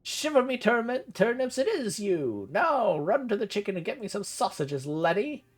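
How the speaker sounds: a daft uncle overjoyed to see his nephew; excited old uncle. Acted. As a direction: cry it out like a batty old hermit. Gerhard recognizes his nephew.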